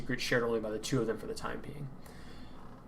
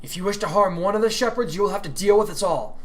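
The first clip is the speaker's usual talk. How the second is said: higher, louder warning; shouting back; 8 semitones higher, 6 dB louder than usual. Acted. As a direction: defy them across the dark. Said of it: "Kiara shouted back into the night".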